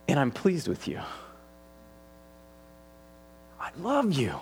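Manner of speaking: God's tender voice. Acted. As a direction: tenderly assure them they are loved. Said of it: "Listen to his voice now".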